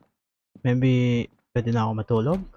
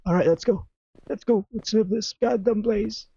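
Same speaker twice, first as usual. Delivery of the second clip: scared horror game streamer; 10 semitones higher than usual. Real, not acted. Spooked player wants to flee.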